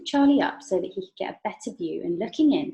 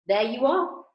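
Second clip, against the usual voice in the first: gleeful, a touch louder; triumphant; 5 semitones higher, 5 dB louder than usual. Acted. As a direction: crow it like you've won. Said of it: "he cried triumphantly".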